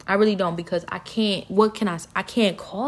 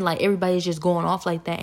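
usual speaking voice

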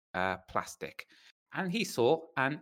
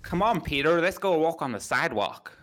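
higher, louder put-on American accent; 4 semitones higher, 4 dB louder than usual. Shown as a demonstration. British speaker trying to talk American for friends, put-on American accent. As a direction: exaggerate an American accent with punch. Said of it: "talk American".

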